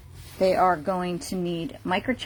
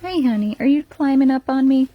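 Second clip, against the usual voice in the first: louder bright greeting to a puppy; excited greeting; 5 semitones higher, 8 dB louder than usual. Real, not acted. Greeting the mother dog climbing on her, louder.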